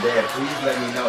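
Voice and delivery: loud begging plea, pitch up; pleading to dad. Retold or imitated. Son loudly begging his dad for the caramel cake recipe. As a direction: beg your dad loudly for the secret.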